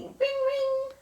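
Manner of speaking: high, sing-song ring ring like a bicycle bell; bell ring imitation. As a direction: chime it out high like a little bell. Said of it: "A bicycle bell ringing".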